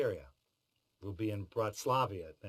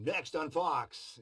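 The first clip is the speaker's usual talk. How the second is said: slow, higher TV promo read; announcer voice; 6 semitones higher, much slower than usual. Retold or imitated. Voice actor showing a routine promo line, announcer read. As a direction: sell it like a network promo, drawn out. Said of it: "Regular voiceover sessions".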